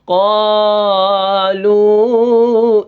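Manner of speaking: both long vowels of "قالوا" hugely drawn out; over-lengthened letters. Shown as a demonstration. Teacher shows a wrongly added letter, over-lengthened recitation. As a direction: hold "قالوا" as a slow sustained chant, aa and oo stretched. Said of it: "کہیں حرف بڑھا دے".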